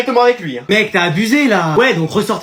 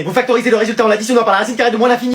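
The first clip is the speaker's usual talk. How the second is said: fast, rattled-off summary; rapid-fire lecture; much faster than usual. Acted. Teacher speeds through the summary again. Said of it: "Ça y est, il recommence".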